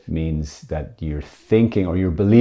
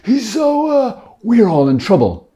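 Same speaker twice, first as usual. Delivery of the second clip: high, breathless fretting piety; hammed-up anxious holy voice; mock scrupulous believer; 12 semitones higher, 4 dB louder than usual. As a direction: play the terrified pious soul. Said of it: "It's kind of like".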